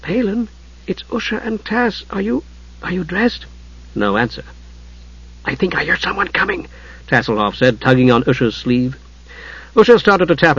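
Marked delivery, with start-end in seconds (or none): 0.0-3.5 high, hushed, hesitant enquiry; soft voice. A narrator's reading. Usha checking if Palin is dressed, soft-voiced. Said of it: "she said in a soft voice". 5.5-6.7 high, softened urgent aside; hushed warning. A narrator's reading. Tas tugging Usha's sleeve in the hall, hushed warning.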